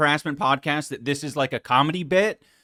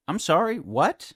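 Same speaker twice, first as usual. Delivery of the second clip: normal talking voice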